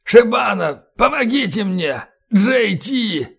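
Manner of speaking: high, strained calls for help; wounded man's pleading cry; pained plea. A narrator's reading. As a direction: call to comrades in distress. Wounded colonel calling for help.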